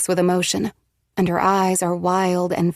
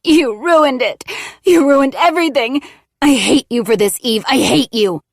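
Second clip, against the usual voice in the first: higher, louder fake sobs through the words; pretend sobbing; 7 semitones higher, 7 dB louder than usual. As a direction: fake a sob while blaming Eve. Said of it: "She pretends to sob".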